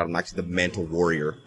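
low, soft and quick; hushed realization. Real, not acted. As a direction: say it quietly to yourself. Boast said low, then repeated louder.

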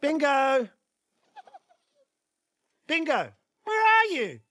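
searching singsong call. Acted. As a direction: call through the house, voice swooping. Dad calls for hidden child.

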